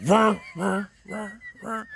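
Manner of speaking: high fart noise fading away like an echo. Retold or imitated. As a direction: let the fart echo and die out. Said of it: "And it just echoed".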